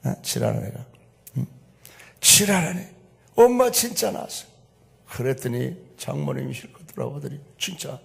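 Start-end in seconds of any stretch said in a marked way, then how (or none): 0.2-2.9 Chungcheong dialect. Shown as a demonstration. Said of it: "충청도 말로 지랄하네". 3.4-4.5 pleading daughter. Retold or imitated.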